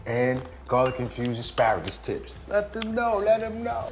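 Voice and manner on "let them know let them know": hype call. Real, not acted. Hyping her up.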